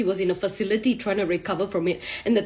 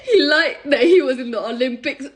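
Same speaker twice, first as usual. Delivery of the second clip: imitated wail, louder; mock crying; 3 semitones higher, 5 dB louder than usual. Wife telling his family, mock tearful wailing. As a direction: wail it out as a hammed-up sob. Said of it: "at this point she's crying".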